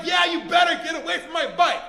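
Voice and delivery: loud, high shouted warning; angry dad voice. Acted. Owner warning off a bike thief, angry dad shout. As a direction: drive the thief off like a furious dad. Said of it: "in my best angry dad voice".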